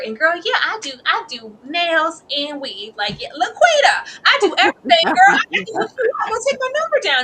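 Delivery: high, loud hustler's pitch; eager Keisha. Acted. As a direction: sell yourself to a new client, shouting it. Voices Keisha pitching her services.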